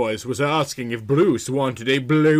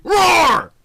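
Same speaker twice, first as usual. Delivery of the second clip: high, loud mock roar; a flirty little roar at him; playful roar; 8 dB louder than usual. Woman teasing Rory with a loud playful roar.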